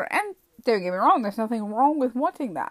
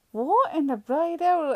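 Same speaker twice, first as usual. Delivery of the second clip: higher-pitched baffled exclamation; acted-out bewilderment, pitched up; exasperated disbelief; 6 semitones higher than usual. Driver baffled by the long police wait, exasperated.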